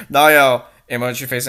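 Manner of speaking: ordinary delivery, nothing marked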